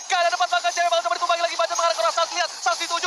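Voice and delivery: rising hyped play-by-play; esports caster. Real, not acted. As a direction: call the fight fast and high. Live play-by-play in teamfight. (gaya komentator esports)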